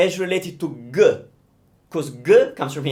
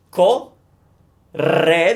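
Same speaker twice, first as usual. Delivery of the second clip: Co and re said apart; split syllables; 5 dB louder than usual. Shown as a demonstration. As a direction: break correre into syllables. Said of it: "because it's double R".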